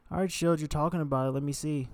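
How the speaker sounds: sister voice. Retold or imitated. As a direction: lightly mimic a skeptical younger sister. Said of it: "that's kinda what my sister would say".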